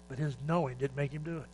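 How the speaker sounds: ordinary talk, nothing marked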